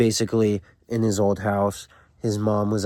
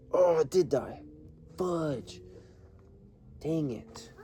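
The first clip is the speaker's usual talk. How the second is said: higher-pitched, deflated exclamation; real dismay; 7 semitones higher, 7 dB quieter, slower than usual. Real, not acted. Host finds his recorder has died, dismayed. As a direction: let out a dismayed mild curse, higher. Recording device dies mid-video.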